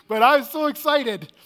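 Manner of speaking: loud, high and slowed with glee; thrilled. Real, not acted. Telling the audience about the boat ride, loud and thrilled. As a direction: let the excitement burst out.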